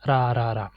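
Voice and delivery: single tapped R in "ra"; "ra, ra, ra" with a soft simple R; simple Spanish R. Shown as a demonstration. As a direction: say "ra" with one light tongue tap per R. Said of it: "it should sound like simple R".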